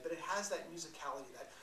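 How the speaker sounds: normal talking voice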